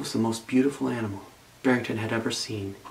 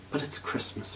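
softer, sighing reply; sighed; 9 dB quieter than usual. A narrator's reading. Lonely bunny sighing to the wolf, soft sigh. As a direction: breathe it out as a sigh. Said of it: "Barrington sighed".

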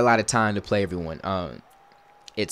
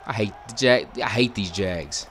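ordinary delivery

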